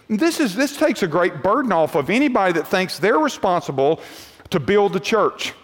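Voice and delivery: usual speaking voice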